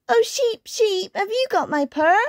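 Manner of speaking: high, pleading kitten voice; squeaky storybook kitten; little kitten. A narrator's reading. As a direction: ask it like a tiny anxious kitten. Kitten asks sheep in story.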